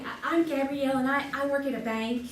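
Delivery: ordinary talk, nothing marked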